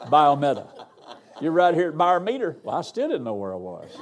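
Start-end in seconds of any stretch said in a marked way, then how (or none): 0.0-0.7 louder imitated Arkansas country pronunciation; mimicked country accent on a place name. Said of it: "I can't say it like she said". 1.4-2.6 higher, louder imitation of an Arkansas country woman; mimicked country twang on "Byermeter"; imitated country accent. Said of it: "I can't say it like she said".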